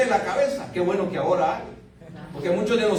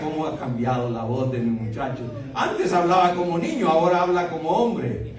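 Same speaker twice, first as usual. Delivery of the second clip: marveling father; 5 semitones lower than usual. Retold or imitated. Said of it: "y yo decía".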